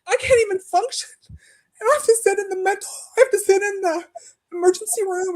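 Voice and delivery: a mock-desperate plea close to crying; retold distress. Telling the hospital she can't even function, near tears. Retelling her own plea, in despair.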